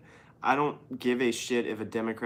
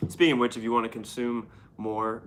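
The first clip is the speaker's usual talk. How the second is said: ordinary delivery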